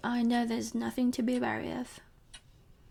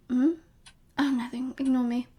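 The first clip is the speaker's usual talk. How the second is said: ordinary talk, nothing marked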